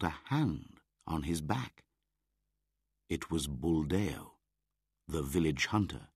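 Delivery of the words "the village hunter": plain reading voice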